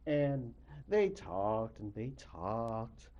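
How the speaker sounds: ordinary delivery, nothing marked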